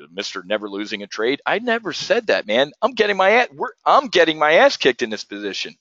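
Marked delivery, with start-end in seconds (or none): 1.5-4.5 a much higher, indignant denial; protesting. Real, not acted. Trader rebutting a viewer's mocking nickname, with high indignant protest. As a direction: push back, pitched up.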